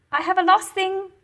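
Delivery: put-on a boy calling to the receptionist. A narrator's reading. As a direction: call out to get attention. Narrator voices boy calling out.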